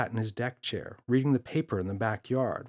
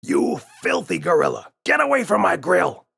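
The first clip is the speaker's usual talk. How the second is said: high, loud furious yelling; furious shout; 7 semitones higher, 8 dB louder than usual. A narrator's reading. Father chasing the gorilla off his grill, furious shout. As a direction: rage at the intruder. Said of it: "shouted Father".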